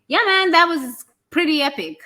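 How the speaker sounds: high, loud, delighted exclamation; impressed. Acted. Acknowledging surviving the pain, excitedly impressed. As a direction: look back and cheer your own feat.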